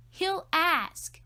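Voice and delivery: high, loud gleeful jab; sister's jab. A narrator's reading. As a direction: crow it out loud. Sister insisting.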